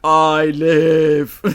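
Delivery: loud creepy echo of the line; creepy Proteus voice. Retold or imitated. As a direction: boom it like a robot child. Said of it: "in the creepy Proteus voice".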